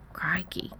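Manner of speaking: softly muttered exclamation; muttering. A narrator's reading. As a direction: mutter it under your breath. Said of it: "Kevin muttered suddenly".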